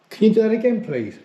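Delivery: loud, high call to the spirit; calling out loudly. Real, not acted. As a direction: project it into the dark room.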